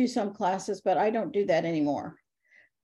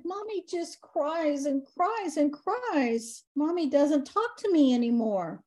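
put-on higher, slow, sad child's voice; little girl voice; 7 semitones higher than usual. Ghost girl telling the medium about her mommy, sad and small. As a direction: speak like a lonely little girl.

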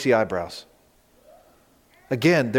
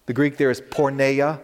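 ordinary delivery

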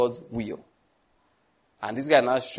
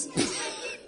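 high squeaky laugh; 8 dB quieter than usual. Real, not acted. Preacher laughs at own joke.

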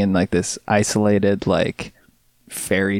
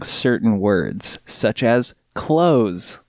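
drawn out, slow and deliberate; slow enunciation; much slower than usual. Shown as a demonstration. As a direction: stretch each word out slowly. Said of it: "unique way of enunciating".

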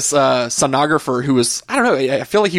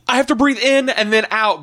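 panicked complaint; 8 semitones higher than usual. Retold or imitated. Retold panicked complaint, hyperventilating.

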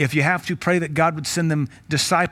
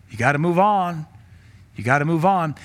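usual speaking voice

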